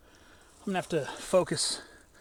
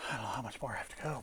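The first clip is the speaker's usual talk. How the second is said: quick, hushed words to himself; fast whisper; 7 semitones lower, 9 dB quieter, faster than usual. Real, not acted. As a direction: whisper it out of breath. Tired on a steep slope.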